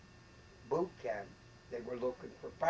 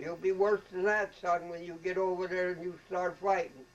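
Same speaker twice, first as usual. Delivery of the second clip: raised, higher barking drill sergeant voice; retold officer yelling, louder and higher; mock colonel holler; 6 semitones higher, 6 dB louder than usual. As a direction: holler it like the colonel chewing out a private. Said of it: "hollering at 'em".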